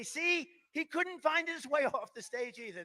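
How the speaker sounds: high, slow sneering mock; mocking press voice. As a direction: sneer like a gloating reporter. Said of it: "They say".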